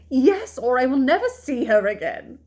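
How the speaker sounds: loud, high ultimatum; outraged mother's outburst; indignant mother. A narrator's reading. Angry mother gives her ultimatum.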